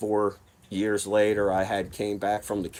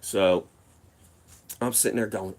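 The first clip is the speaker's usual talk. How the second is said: ordinary delivery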